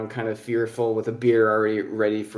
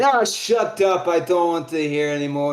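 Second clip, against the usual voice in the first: irritated dwarf outburst; 4 semitones higher, 3 dB louder than usual. Acted. Old dwarf silencing the bartender, raised irritated voice. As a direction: cut them off as a fed-up old dwarf.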